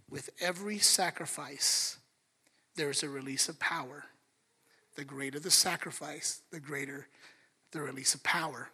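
low, soft, hushed menace as the Satanist; quietly chilling, mostly breathed; a hint of a sinister whisper; eerie low whisper. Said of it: "And she said".

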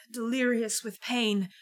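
usual speaking voice

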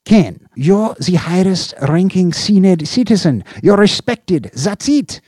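high, loud, pushy boss voice; a boss grilling his subordinate; Slope, demanding boss. Acted. Police chief grilling an officer over missing agents, demanding.

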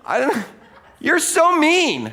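high, slow whining sulk; whiny complaint. Retold or imitated. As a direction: whine like a sulking child. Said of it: "what I'll do with God is saying".

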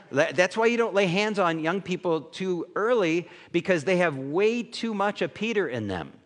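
ordinary delivery

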